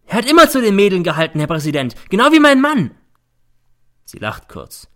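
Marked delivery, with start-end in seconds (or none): 0.1-2.9 fast, very high and loud whine; shrill mother. A narrator's reading. Mother appealing to the judge, shrill and fast. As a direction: rush the complaint to the judge.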